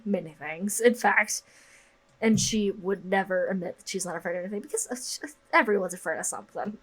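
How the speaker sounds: normal talking voice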